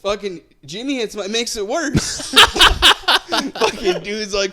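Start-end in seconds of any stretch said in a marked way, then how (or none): 3.3-4.5 high, loud laugh breaking through; real laughing burst. Real, not acted. Laughing at how Jimmy made it worse, real laughter. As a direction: lose it laughing mid-story.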